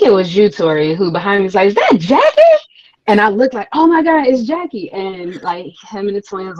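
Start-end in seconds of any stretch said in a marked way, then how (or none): 1.7-2.4 high, loud astonished question; startled exclamation. Retold or imitated. Quoting someone's startled sighting. 3.7-4.9 re-enacted high-pitched amazement; starstruck. Reliving a starstruck sighting.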